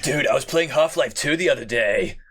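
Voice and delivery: laughing bro voice; jock voice. Pretend. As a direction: tell it like an excited gym bro. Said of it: "brings out your jock voice".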